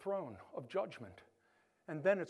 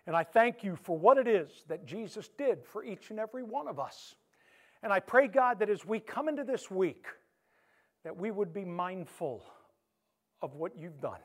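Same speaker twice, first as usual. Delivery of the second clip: earnest pastoral prayer, slowed on key words; closing prayer; 5 dB louder than usual. Acted. Pastor praying over the congregation, earnest and fervent. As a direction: pray for the congregation with feeling.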